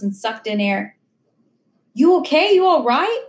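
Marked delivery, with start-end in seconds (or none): 1.9-3.2 alarmed, higher and louder questions; worried but trying to hide it; urgent you okay, fear held back; anxious check-in. Retold or imitated. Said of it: "trying to hide my fear".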